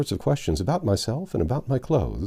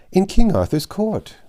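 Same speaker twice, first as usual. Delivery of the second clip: bright page answer; 10 semitones higher, 7 dB louder than usual. A narrator's reading.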